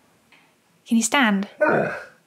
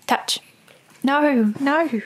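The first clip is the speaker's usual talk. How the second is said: ordinary delivery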